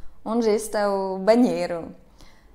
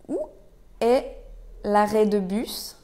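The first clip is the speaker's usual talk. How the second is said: word by word. Shown as a demonstration. Teacher breaks question down for learners.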